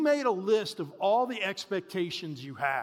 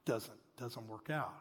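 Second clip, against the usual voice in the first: deflated; 10 semitones lower, 15 dB quieter, slower than usual. Retold or imitated. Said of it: "you're like".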